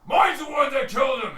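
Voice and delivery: high, very loud shout; squabbling orc at full volume; angry orc claim. Acted. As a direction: bellow your claim to the kill. Orc claims the kill in quarrel.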